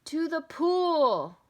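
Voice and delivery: loud, drawn-out high yell. A narrator's reading. Firemen shouting where to go, long yell. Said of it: "yelled the firemen".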